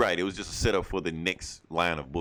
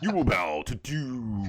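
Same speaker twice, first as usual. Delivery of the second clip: slow regal villain voice, lightly metallic; 4 semitones higher, slower than usual. Retold or imitated. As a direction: intone it like a robotic tyrant. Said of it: "with, like, a metallic voice".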